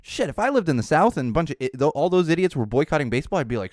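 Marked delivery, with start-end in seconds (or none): none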